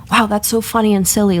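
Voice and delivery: ordinary talk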